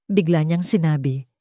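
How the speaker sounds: ordinary delivery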